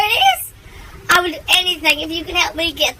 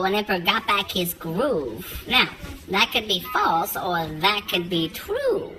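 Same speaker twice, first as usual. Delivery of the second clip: riddling bridge keeper; 8 semitones lower, 4 dB quieter than usual. Acted. Bridge keeper quizzing the rabbit, lower voice.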